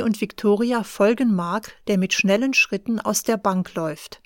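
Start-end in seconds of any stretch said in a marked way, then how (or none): none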